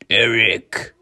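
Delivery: loud, drawn-out creature call; eerie creature voice. A narrator's reading. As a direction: call out as an uncanny beast. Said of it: "like a thousand voices speaking together".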